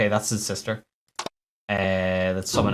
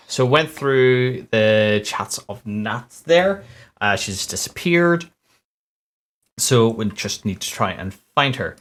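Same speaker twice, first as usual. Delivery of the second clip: ordinary delivery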